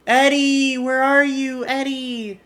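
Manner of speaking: a hammed-up crazy call; mock calling out. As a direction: call out as if searching. Skinner calling for Eddie.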